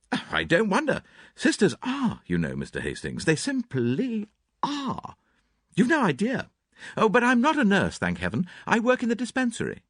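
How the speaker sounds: put-on high, lively young girl; chattering, teasing young woman; Cynthia. A narrator's reading. Cynthia voiced.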